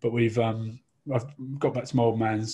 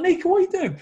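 laughing; 6 dB louder, faster than usual. Real, not acted. Calling out at the table.

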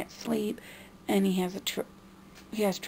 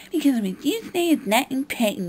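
dismissive mock; 5 semitones higher, 6 dB louder than usual. Mocking mom's dismissive reply.

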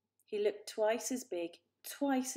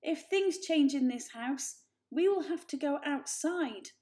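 louder, higher, urgent; 7 semitones higher, 8 dB louder than usual. A narrator's reading. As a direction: fight to be heard. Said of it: "struggling to regain control".

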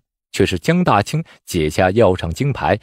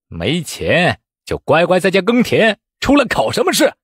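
angry bellow; 7 semitones higher than usual. A narrator's reading. Examiner rushing at a penniless candidate, berating angrily. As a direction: scold him off with contempt. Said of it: "喝道".

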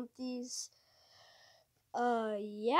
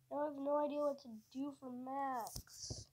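whispered frustration; 7 dB quieter than usual. Real, not acted. Gamer despairing after missing the call, whispered. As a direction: whisper it, defeated.

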